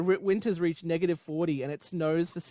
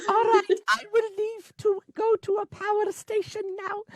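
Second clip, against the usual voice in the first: [name] impression. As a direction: coo it like [name] playing an alien. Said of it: "Goodbye from [name]".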